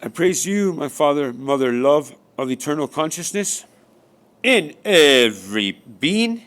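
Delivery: loud, rising praise, the last words stretched very slow; fervent prayer. Acted. Speaker breaks into praise of Father and Mother, loud and fervent. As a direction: cry it out to the heavens.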